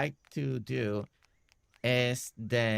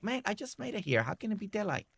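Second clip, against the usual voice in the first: higher-pitched mock complaint; lightly put-on voice, pitched up in dismay; lightly voiced latecomer; 9 semitones higher, 3 dB quieter, faster than usual. Player speaking for the late villager, lightly voiced complaint.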